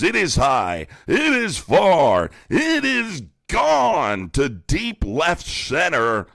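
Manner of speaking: mock home-run call. Mocking Trump supporters as a baseball announcer, hammed-up.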